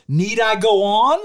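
high, loud, slow indignant challenge; imitated anger. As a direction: mimic an exasperated rapper, drawing it out. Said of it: "he was angry".

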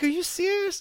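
very high squeaky incredulous question; mock outrage at being refused; squeaky stunned whine; disbelief. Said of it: "I was just like".

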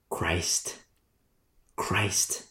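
breathy whispered Christ. Real, not acted. Preacher whispers the answer to his congregation, hushed whisper. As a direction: drop to a bare whisper on Christ.

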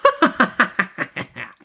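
high, rapid mocking cackle; malicious witch cackle. A narrator's reading. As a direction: cackle with wicked glee. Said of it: "she laughed with malice".

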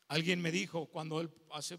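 normal talking voice

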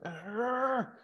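high frustrated gamer grunt; raging yell. Retold or imitated. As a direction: let out a raging arr. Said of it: "Just like".

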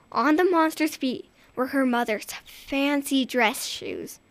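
ordinary delivery, nothing marked

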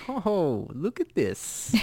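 lightly faked, unplaceable accent; a hint of a muddled foreign accent; Jean-Claude Van Damme. Retelling the movie hero zooming in, muddled accent. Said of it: "I don't know what accent that is".

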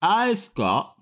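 slow repeat, tongue held out; holding tongue with fingers. Real, not acted. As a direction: drag it out while gripping tongue. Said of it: "can I hear again".

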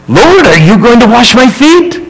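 a disciple aghast that his master would serve him; Peter questioning. Acted. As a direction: read Peter's line as a startled disciple. Peter voiced in a dramatic reading.

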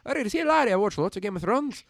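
put-on high, animated Scottish accent. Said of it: "so good at a Scottish accent".

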